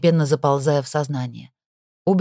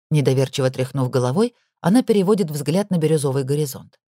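usual speaking voice

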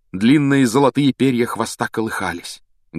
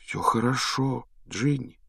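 reassuring; 2 semitones higher, 7 dB quieter than usual. Acted. Boy comforting the frightened girl he rescued, softly reassuring. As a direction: comfort her gently.

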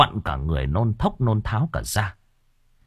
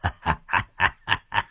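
cackling laugh; 2 semitones lower, 5 dB quieter than usual. A narrator's reading. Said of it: "Tổng cựu cười khanh khách".

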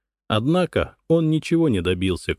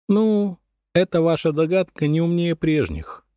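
dismissive; 6 semitones higher than usual. Acted. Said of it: "отмахнулась Элис Фабиан".

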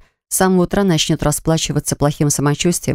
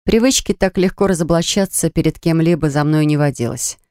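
ordinary delivery

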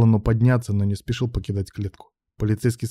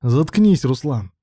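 raised, rasped command to shut up; hoarse retort; 3 semitones higher, 4 dB louder, slower than usual. A narrator's reading. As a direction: rasp it out sharply. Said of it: "прохрипел Алан".

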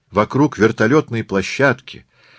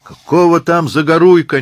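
loud, high jeering shout; crowd heckle; 9 semitones higher, 6 dB louder than usual. Acted. Tourist in the crowd objects, heckling loudly. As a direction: yell it from the crowd. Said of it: "несётся из толпы".

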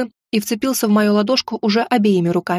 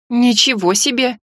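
stunned. Acted. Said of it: "обалдело выдохнула я".

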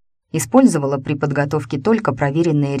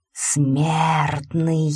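eerie otherworldly hiss; a faint voice from beyond; ghostly whisper. A narrator's reading. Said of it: "тихий, на грани слышимости шёпот". (потусторонний шёпот)

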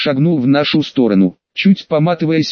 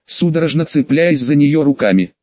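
ordinary delivery

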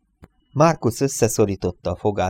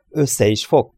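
raised, higher voice cutting back; contained shout of angry contradiction; 5 semitones higher, 5 dB louder than usual. A narrator's reading. Marcus answers amused Reynolds, shouting angrily. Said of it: "kiabált Marcus".

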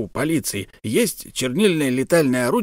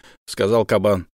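usual speaking voice

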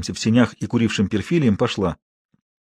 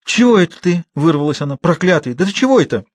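high, loud frightened protest; shocked outcry; 6 semitones higher, 3 dB louder than usual. Acted. Girl tears free, protesting the forced kiss. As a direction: push him away with your voice.

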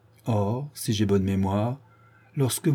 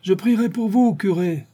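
much higher, mocking, laughing it out; bursting out laughing; 10 semitones higher than usual. A narrator's reading. Old Meffre mocking the departing priest, laughing. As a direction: toss the words back with a mocking burst of laughter. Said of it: "dans un grand éclat de rire".

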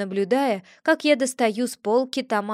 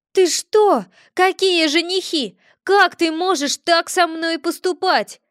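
high, louder outraged cry; cat protesting about the suitor, high and loud; indignant exclamation; 7 semitones higher, 6 dB louder than usual. A narrator's reading. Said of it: "воскликнул Виля".

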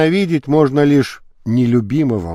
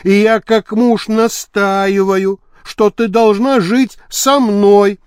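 higher, slow, drawn-out insistence; insistent husband; 4 semitones higher, slower than usual. Acted. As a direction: press a husband's claim word by word. Муж настаивает на своих правах. (настойчиво, с растяжкой)

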